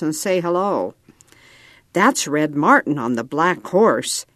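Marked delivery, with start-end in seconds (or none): none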